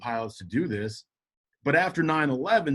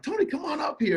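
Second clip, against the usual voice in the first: much higher, eager call up the aisle; excited call; 13 semitones higher than usual. Retold or imitated. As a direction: wave your old friend forward, thrilled. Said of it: "I'm so excited to see my brother".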